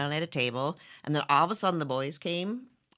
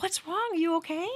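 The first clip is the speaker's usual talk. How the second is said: concerned husband; 12 semitones higher than usual. Retold or imitated. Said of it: "and he's like".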